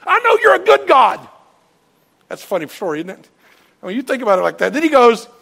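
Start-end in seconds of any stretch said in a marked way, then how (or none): 0.1-1.2 high, loud angry shouting; furious shout. Retold or imitated. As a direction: hurl it at God in a rage. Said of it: "He's really mad at God".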